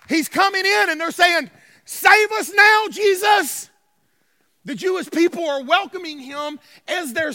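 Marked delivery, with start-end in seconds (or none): 1.8-3.7 slow, high, full-voiced shout; crowd shouting. Preacher voices crowd greeting Jesus, shouting. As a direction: belt it out word by word, pleading for rescue. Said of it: "They were shouting".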